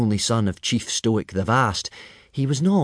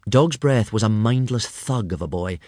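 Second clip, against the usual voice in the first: ordinary talk, nothing marked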